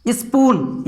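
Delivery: स्पून said as "is-poon"; leading "is" sound. Shown as a demonstration. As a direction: start स्पून with a short "i". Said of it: "यहाँ पर भी इस हो रहा है".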